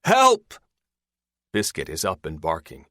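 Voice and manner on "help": high, loud cry for help; yell. A narrator's reading. Hurt woman calling the others from below, yelling. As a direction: yell urgently for rescue. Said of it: "Terry yells".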